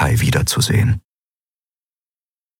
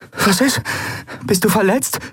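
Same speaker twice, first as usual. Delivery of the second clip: much higher startled worried questions; alarmed. A narrator's reading. Endriel seeing her lover wince, alarmed. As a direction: ask in sudden alarm. Endriel sees Kai in pain.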